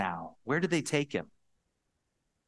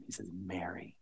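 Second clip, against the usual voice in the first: softly whispered "Mary"; Jesus gently whispering her name; 5 semitones lower, 12 dB quieter than usual. Acted. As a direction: breathe the name tenderly.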